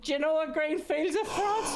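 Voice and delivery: high, mocking pub-punter request; mimicking a punter. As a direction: ape the drunk request up high.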